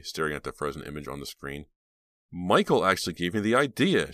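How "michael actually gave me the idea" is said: eager and louder; excited. A narrator's reading. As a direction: burst out with the news. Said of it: "she told him excitedly".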